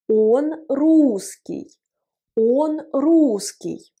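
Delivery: very slow, clear Он русский; slow teaching demonstration; slow model phrase. Shown as a demonstration. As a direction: spell the phrase out slowly for learners.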